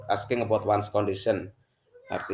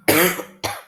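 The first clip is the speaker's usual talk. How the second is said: acted cough; 10 semitones higher, 10 dB louder than usual. Sick character in dialogue.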